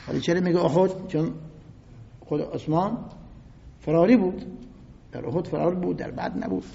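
ordinary talk

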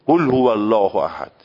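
imitating a Turkish speaker's قل in قل هو الله احد; Turkish-accented recitation. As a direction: Turkish accent on the first consonant of قل. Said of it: "این قل میگه، گاف نمیگه".

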